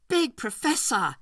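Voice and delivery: a mocking call with a hint of laughter. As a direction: taunt him from afar with glee. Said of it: "he called in his voice, wheezing with the laughter in it".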